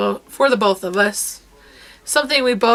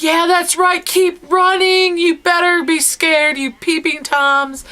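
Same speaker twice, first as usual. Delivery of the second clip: taunting yell; 7 semitones higher, slower than usual. Retold or imitated. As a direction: gloat at them as they run. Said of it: "I was like".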